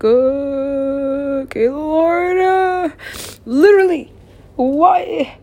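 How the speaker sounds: high, loud wail upward; anguished plea. Real, not acted. Speaker begging the Lord to say why, anguished wail. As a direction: cry it out high to the heavens.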